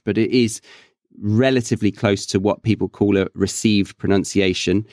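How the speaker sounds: ordinary talk, nothing marked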